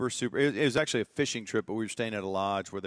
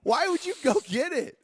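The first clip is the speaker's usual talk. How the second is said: very high, loud, drawn-out dad squawk; hammed-up high-pitched fury; appalled dad; 6 dB louder, slower than usual. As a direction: imitate dad's outraged refusal, stretching the words. Dad's disgusted refusal acted out.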